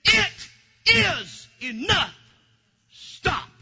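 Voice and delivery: loud, slow, commanding divine voice; voice of God. Retold or imitated. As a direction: boom it like God ending the matter. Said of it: "the Lord said".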